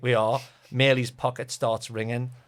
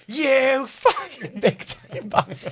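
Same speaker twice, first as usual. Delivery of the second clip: high, loud, slow teasing shout through laughter; laughing mock insult; 13 semitones higher, 6 dB louder, much slower than usual. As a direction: roar it at a teammate, cracking up. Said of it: "Boys went".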